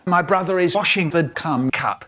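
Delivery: ordinary delivery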